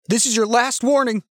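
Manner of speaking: high, tense final warning; nervous warning. A narrator's reading. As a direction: warn them off with rising nerves. Armed standoff, gun shaking in hand.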